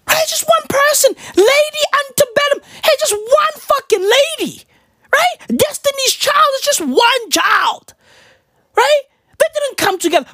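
pitched high, near-crying shout; high exasperated rant. Acted. Comic insisting Destiny's Child is one child, high shouting. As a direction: shout it high like it's obvious.

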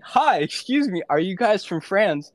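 addressing a suspicious French couple, high and nervous; nervous polite ask. Retold or imitated. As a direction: pipe up nervously at a stranger.

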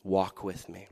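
Jesus kneeling beside us, soft; tender invitation. Retold or imitated. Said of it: "he takes our hand and says".

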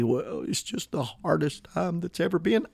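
soft, feeble imitation of a complainer; mock defeatist. Preacher mimicking eleventh hour mentality, mock defeatist. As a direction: softly bemoan it as a parody. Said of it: "that eleventh hour mentality".

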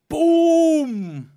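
loud, high triumphant shout; shouting boom. Real, not acted. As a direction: celebrate the goal with a yell. Goal celebration.

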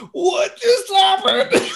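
high, loud, slow laughing cry; acted-out giddy shock, voice way up; laughing disbelief. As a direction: shout it through giddy laughter. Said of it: "And I'm laughing".

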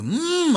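hammed-up high "mmm" of delight; an overdone taste-approving hum; exaggerated high pleased hum over a bite; mock savoring hum. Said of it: "hago caras como de".

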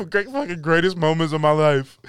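normal talking voice

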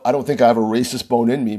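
ordinary talk, nothing marked

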